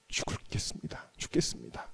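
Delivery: hushed whisper, almost breathless; whispered despair. Retold or imitated. Despairing man whispering to God he will die. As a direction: let the words die away into a whisper.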